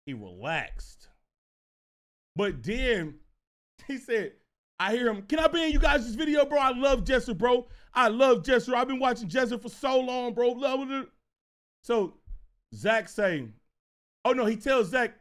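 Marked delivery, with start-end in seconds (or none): 5.2-11.1 higher, excited fan gushing in a rush; excited, pleading fanboy voice; eager fan. Retold or imitated. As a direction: gush like a kid begging to join in. Acting eager young fan begging.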